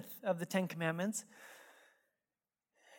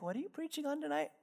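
sugary-sweet, singsong, softly lilting up; sweet pointed question; 8 semitones higher, 7 dB quieter, faster than usual. Retold or imitated. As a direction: imitate wife, high, soft and quick. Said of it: "Jen just so sweetly".

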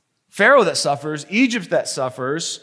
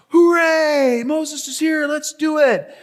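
much higher and louder cheer; cheering crowd; 10 semitones higher, 3 dB louder than usual. Acted. Hebrews celebrating Moses' arrival, high excited cheer. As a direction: whoop it like a delighted crowd. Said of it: "the people are like".

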